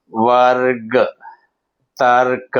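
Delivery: వర్గ, తర్క with the r held before the last consonant; half-r demonstration. Shown as a demonstration. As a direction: model each word separately: వర్గ ... తర్క. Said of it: "రా సగం పలకాలి అన్నప్పుడు".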